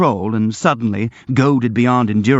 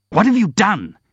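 raised, furious shout; 9 semitones higher than usual. A narrator's reading. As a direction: hurl it at her in fury. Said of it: "he shouted".

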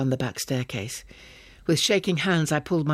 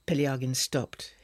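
plain reading voice

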